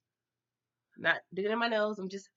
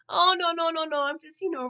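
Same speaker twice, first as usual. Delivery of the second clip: flustered denial; 6 semitones higher than usual. Retold or imitated. Van dodges the question, flustered.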